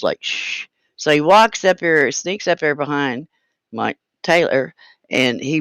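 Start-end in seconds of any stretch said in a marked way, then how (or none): 0.2-0.7 soft conspiratorial shush; hushing shh. Retold or imitated. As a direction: hush them quietly, finger to lips. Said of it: "puts his finger up to his lips".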